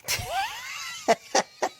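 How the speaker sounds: real laugh jumping very high; high-pitched real cackle; high squealing laugh. Real, not acted. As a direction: burst into a squeaky giggle. Amused at own joke.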